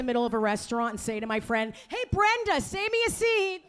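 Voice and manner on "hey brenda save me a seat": high-pitched call across a room. Acted. Woman to her friend in a restaurant, calling out. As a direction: call to a friend over the din.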